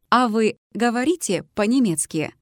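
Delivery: usual speaking voice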